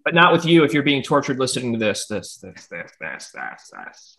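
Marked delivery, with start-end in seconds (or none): 1.8-4.2 trailing off into a low, soft echo; fading echo. Pretend. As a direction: repeat it like a dying echo, softer and lower each time. Outro word repeated, fading away.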